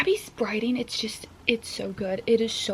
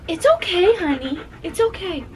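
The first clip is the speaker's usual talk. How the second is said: high pet talk; 9 semitones higher, 4 dB louder than usual. Real, not acted. Owner comforting her panting dog in the storm, high baby talk. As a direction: coo up high like talking to a puppy.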